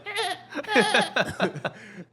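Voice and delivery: big amused laugh; hearty laugh. Real, not acted. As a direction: burst out laughing at the joke. Laughs at echolocation joke.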